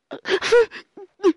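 sudden loud, high laugh; high nervous laugh. Acted. Nervous laugh when threatened.

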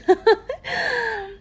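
bright high giggle; high teasing giggle. Acted. Laughing at the listener's boldness, high giggle. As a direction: giggle at him.

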